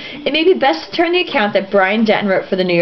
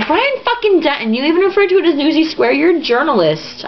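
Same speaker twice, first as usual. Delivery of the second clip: high, fast exasperated rant; indignant scolding of the writer; mock outrage; 6 semitones higher than usual.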